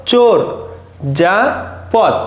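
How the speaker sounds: চোর, যা, পথ, each syllable set apart with a pause. Shown as a demonstration. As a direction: break the word into its syllables, one at a time. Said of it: "আমি যদি এই শব্দটাকে ভেঙে ভেঙে উচ্চারণ করি".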